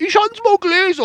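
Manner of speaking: very high squeaky mock voice. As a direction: squeak it out, mocking. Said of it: "Als wenn du das Grundgesetz".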